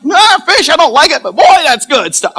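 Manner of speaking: loud, high-pitched acted protest turning keen; hammed-up dislike flipping to relish; mock reluctant enthusiasm. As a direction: play disgust giving way to loud approval. Acts out lovestruck eater converted.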